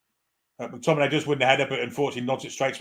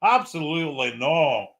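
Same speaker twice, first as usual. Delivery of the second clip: put-on Scottish accent; 2 semitones higher than usual. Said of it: "Can I do the rest of the commentary in a Scottish accent?".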